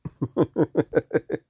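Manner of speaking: loud high laugh breaking out; amused laughing fit; real burst of laughter. Real, not acted. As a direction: crack up at the comments. Reading funny comments, laughs aloud.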